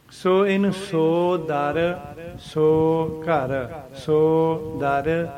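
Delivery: ਸੋ drawn out, separated from ਦਰ; slow, deliberate, gap between ਸੋ and ਦਰ; slow spaced so dar. Shown as a demonstration. Teacher drilling the pause in ਸੋ ਦਰ for students. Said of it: "ਐਂ ਬੋਲਣਾ".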